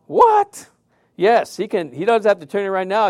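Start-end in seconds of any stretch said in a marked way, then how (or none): 0.1-0.7 very high, loud outburst of disbelief; disbelieving what. Real, not acted. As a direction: sound floored by the news. Incredulous at a whole year.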